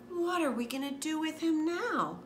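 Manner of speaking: a mom at her wits' end; lightly voiced exasperated mom; fretting mom.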